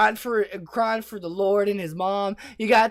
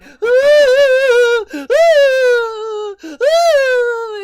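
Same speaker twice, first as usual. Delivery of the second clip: high, loud hysterical crying ah; scream-cry in hysterics; hysterical wail; 16 dB louder, much slower than usual. Retold or imitated. Clerk wails hysterically at the robber, a hammed-up high cry.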